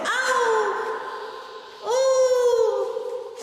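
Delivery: high whoops ringing off concrete; metallic flutter echo. Real, not acted. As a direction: shout out to test the echo. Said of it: "Listen to the echo".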